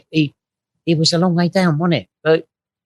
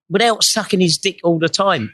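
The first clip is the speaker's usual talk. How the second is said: ordinary talk, nothing marked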